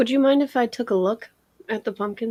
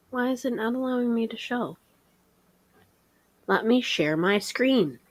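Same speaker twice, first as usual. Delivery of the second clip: usual speaking voice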